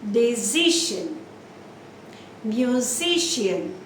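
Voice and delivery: slow Decision, Musician, leaning on CI and SI; second-last syllable stress. Shown as a demonstration. Teacher drills examples, slow middle stress in Decision, Musician. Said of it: "the second syllable from the last".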